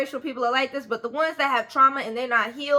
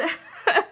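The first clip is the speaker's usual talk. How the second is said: short laugh; 7 semitones higher than usual. Real, not acted. Laughs after heated rant.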